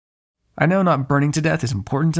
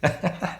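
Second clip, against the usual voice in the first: short teasing chuckle; brief laugh, rising at the end; a quick, amused little laugh; 4 semitones higher, 3 dB quieter than usual. Acted. Vampire flirts, amused.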